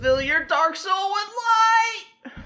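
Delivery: high, loud, earnest anime hero; dramatic hero. Pretend. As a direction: declare it like a shonen hero. Mock-dramatic anime hero line.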